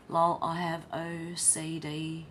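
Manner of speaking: slow breathy whisper. Acted. Reading a chat comment out in a slow whisper. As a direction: whisper it like a secret aside.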